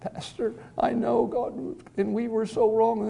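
high-pitched tearful confession, acted out; imitated sobbing. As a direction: mimic a wife sobbing to her pastor. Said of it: "She's just almost uncontrollably sobbing".

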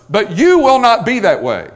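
"you" hit high and loud; emphatic YOU. Shown as a demonstration. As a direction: preach it with a hard punch on "you". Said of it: "the emphatic personal pronoun".

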